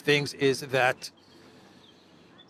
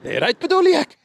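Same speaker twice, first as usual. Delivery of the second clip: Zelensky voice; 8 dB louder than usual. Pretend. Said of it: "imitating Zelensky asking aide".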